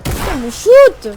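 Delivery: high, loud burst of frustration; exasperated shout. Real, not acted. Player yelling at the game mid-exercise, exasperated shout. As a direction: yell it in real annoyance, stretched out.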